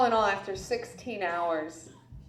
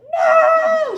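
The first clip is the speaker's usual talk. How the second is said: long, very high comic shriek; scream; 12 dB louder than usual. Retold or imitated. Weird anesthesiologist hearing about the drinks, scream. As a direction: shriek it at full stretch. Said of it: "She screams again".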